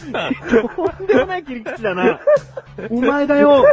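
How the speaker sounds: laughing retort. Real, not acted.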